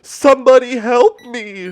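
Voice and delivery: high, loud scream for help; terrified scream. Retold or imitated. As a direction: shriek it out like you're surrounded. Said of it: "I screamed".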